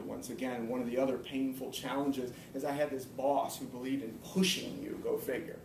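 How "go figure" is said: normal talking voice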